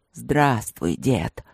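low, lightly growled hello; growled greeting. A narrator's reading. Young tiger greeting his grandfather, lightly growled. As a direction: drop the pitch into a mild growl. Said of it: "прорычал новоприбывший".

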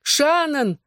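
high, drawn cry of alarm; calling out. A narrator's reading. Mother calls to her daughter as she nears, alarmed cry. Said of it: "снова крикнула женщина".

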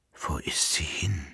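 soft, unvoiced whisper. A narrator's reading. The Emperor, awake before his valet, whispering. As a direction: ask it under your breath, dazed. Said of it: "flüsterte der Kaiser".

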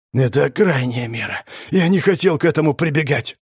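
rasping wounded voice; hoarse wheeze. A narrator's reading. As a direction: wheeze it out, hurt. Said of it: "прохрипел жрец".

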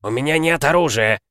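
snapping back in annoyance; irritated protest from the bushes. A narrator's reading. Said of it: "раздражённо сказал". (раздражённо)

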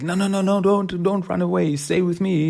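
high-pitched, sustained mock plea; acted frantic coaxing; urgent pleading. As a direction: beg it to stay, pitch held way up. Heroine begs hyena not to flee.

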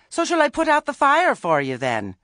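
high loud shout. A narrator's reading. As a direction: call it out across the yard. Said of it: "Poor chick shouted".

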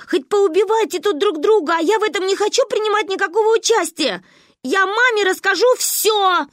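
high, loud tearful rant; hysterical outburst. A narrator's reading. Child threatening to tell mother, hysterical. Unstoppable child rants at friends.

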